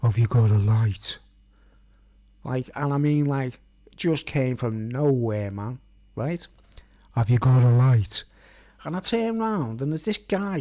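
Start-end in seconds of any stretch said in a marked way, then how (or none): 0.0-1.2 low, louder, menacing stranger in the dark; a hint of a creepy stranger asking for a light. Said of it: "I hear this voice go". 7.2-8.2 loud whisper right behind the head; creepy loud whisper from the dark. Retold or imitated. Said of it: "I hear this voice go".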